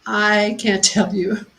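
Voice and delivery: ordinary talk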